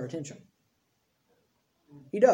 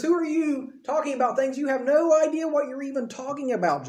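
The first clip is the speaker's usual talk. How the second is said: higher-pitched, incredulous rebuke; challenging God; 7 semitones higher than usual. Acted. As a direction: act out God challenging Job. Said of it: "God comes to Job and he says".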